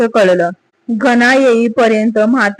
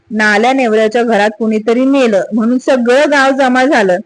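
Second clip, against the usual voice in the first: ordinary delivery, nothing marked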